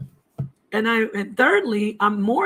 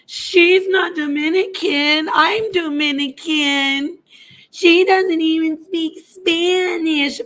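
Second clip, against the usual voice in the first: high, slow mocking voice of an online troll; 7 semitones higher, slower than usual. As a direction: sneer it high and drawn out like the troll.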